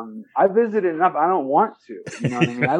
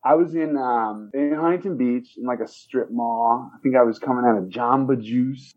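ordinary talk, nothing marked

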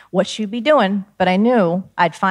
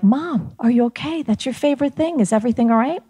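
put-on worried child; 7 semitones higher than usual. Child worried finding radio dismantled.

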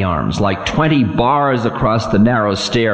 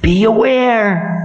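high, ominous voice of Time; stern ancient Time; 13 semitones higher than usual. Acted. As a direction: warn the demon of doom. Time's high voice prophesies.

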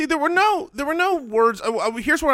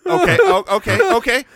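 high, loud rising okays; excited; 5 dB louder than usual. Real, not acted. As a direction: burst out with the idea. Excited realization.

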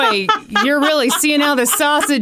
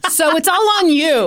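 guilt tripping grandma; 5 semitones higher than usual. Acted. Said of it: "guilt trip from Grandma".

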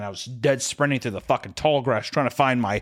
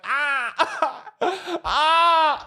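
acted high cries of pain; high pained wail; much slower than usual. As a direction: wail as if just struck down. Acts being hit and wailing.

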